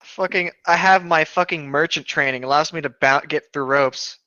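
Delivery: ordinary talk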